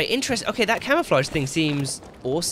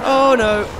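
alarmed; 3 semitones higher, 9 dB louder than usual. Real, not acted.

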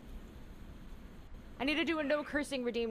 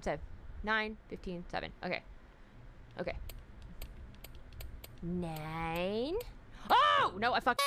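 ordinary delivery

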